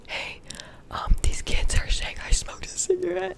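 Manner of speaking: put-on a hurt kid reporting a rumor to her teacher in a whisper; childlike plea. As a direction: play your upset younger self asking the teacher for help. Child telling teacher about rumor.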